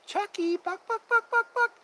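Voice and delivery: high, loud clucking "buck buck"; chicken cluck. Retold or imitated. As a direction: cluck like a chicken to set the dog off. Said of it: "come over and say".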